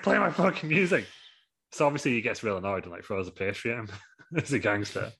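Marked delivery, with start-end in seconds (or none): 0.0-1.1 mock yell. Angry wrestler demanding his music.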